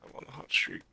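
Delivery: soft unvoiced boast; whispering. Real, not acted. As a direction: whisper it smugly.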